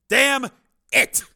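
high, loud, punched words; furious curse. A narrator's reading. Commander learning the launch failed, furious shout. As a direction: explode with rage, each word separate. Commander before hurling the phone.

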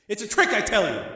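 high, emphatic protest; agitated insistence. Acted. Gangster tells Rick it's a trick, agitated. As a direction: insist on it hotly.

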